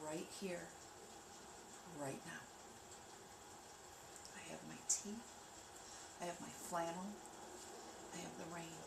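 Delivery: soft, calm grounding voice; guided meditation. Acted. Leading viewers through grounding, soft meditation voice. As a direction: guide them gently into the present.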